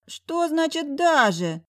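high, slow indignant whine. Acted. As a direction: drag it out, offended. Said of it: "возмутился мой сын".

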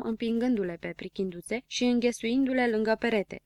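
usual speaking voice

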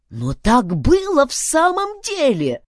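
loud, high-pitched insistence; robot pleading to be believed; insistent. A narrator's reading. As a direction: insist it really happened, voice pushed up. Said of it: "настаивал Филберт".

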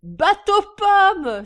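very high, loud, drawn-out whoop; enthusiastic cry. A narrator's reading. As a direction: cry it out in delight. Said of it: "s'écria J B enthousiasmé". (cri enthousiaste)

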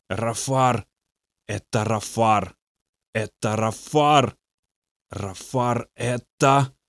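slow, soft, strange demon mumble; mumbling like a broken record. A narrator's reading. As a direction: drone the name slowly like a stuck record. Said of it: "он говорил невнятно, как заезженная пластинка". (медленное, тихое бормотание демона)

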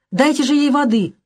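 mild high-pitched cry; shouting. A narrator's reading. Hostess calling for water for Sonya, mild shout. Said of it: "закричала хозяйка".

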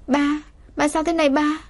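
high-pitched, frightened questioning; a frightened girl pleading for an answer; panicked child. A narrator's reading. Girl to her collapsed father, panicked. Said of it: "cả người run rẩy luống cuống".

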